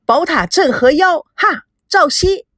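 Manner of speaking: high, loud answering shout; shouting the reply in unison; shouted back. A narrator's reading. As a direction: call the countersign back loudly. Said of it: "同时大叫".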